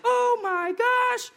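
high, slow, drawn-out amazed oh my gosh; astonished gasp. Retold or imitated. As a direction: stretch it out high like the ticket lady in disbelief. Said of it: "just like that".

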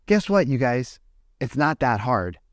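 ordinary talk, nothing marked

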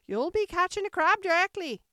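high, loud warning shout; Sheep's cry. A narrator's reading. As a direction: scold loudly as the bossy Sheep. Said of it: "the sheep cried again".

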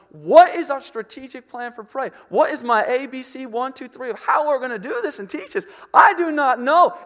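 loud, high, mock-panicked self-questioning; freaking out. Preacher replaying his panic in the shed, hammed-up freak-out. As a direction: act out a flustered outburst, pushing pitch and volume up. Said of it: "And I'm freaking out".

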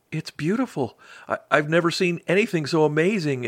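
high, wondering cry of awe; awed exclaim. Acted. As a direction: gasp it out in amazement. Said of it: "exclaimed Little Beetle".

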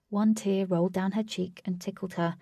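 plain reading voice

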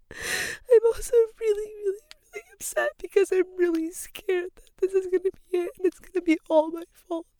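high, voice cracking with real sobs; crying. Real, not acted. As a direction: sob through it, pitch way up. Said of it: "I can't stop crying".

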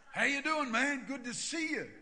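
high, loud, over-keen hello; hearty greeting. Retold or imitated. Pastor greeting the Texan next Sunday, loud and hearty. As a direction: call out an exaggerated cheery greeting.

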